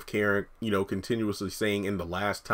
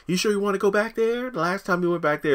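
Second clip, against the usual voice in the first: higher, nosy salon lady; Pam voice; 11 semitones higher, 3 dB louder than usual. Retold or imitated. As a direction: play the nosy friend asking. Pam voiced in retold scene.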